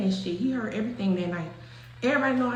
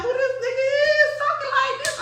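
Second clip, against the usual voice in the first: much higher, laughing retold question; amused disbelief; 13 semitones higher than usual. Friend on the phone laughing in disbelief. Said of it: "my homeboy like".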